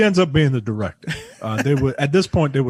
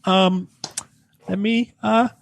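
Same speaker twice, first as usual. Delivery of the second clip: higher, halting imitation with long pauses; awkward hedging; 8 semitones higher than usual. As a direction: stall awkwardly, pitch creeping up. Imitates woman awkwardly deflecting the complaint.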